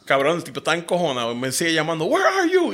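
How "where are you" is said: high-pitched mock demand; imitated angry caller. Angry boss phoning the late driver, high mock demand. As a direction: mimic an irate caller in a high voice.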